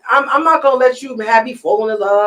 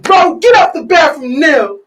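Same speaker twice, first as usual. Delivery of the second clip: raised, slow, emphatic mock yell; higher, louder, drawn-out demand; retold exasperated order; 6 semitones higher, 6 dB louder than usual. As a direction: imitate a fed-up partner calling her out. Retold partner ordering her out.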